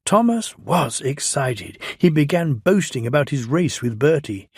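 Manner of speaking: usual speaking voice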